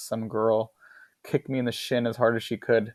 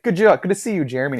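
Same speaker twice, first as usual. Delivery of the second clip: loud, higher imitation of a bully; mock hearty greeting; 7 semitones higher, 7 dB louder, faster than usual. Bar bully greeting his victim, mock hearty. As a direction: put on an over-friendly hello, starting high.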